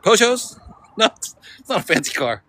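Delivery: high incredulous Peugeots?; incredulous friend. Retold or imitated. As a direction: scoff at the idea, pitch jumping up. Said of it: "She's like".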